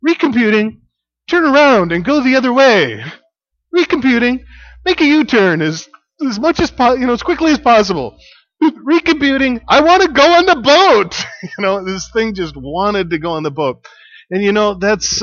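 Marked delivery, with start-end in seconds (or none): 0.1-11.2 higher, louder robotic sat-nav voice; mock GPS voice. Pastor imitating the car GPS nagging them to turn, hammed-up. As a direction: play the GPS that yells and wants the ferry. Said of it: "starts yelling at us".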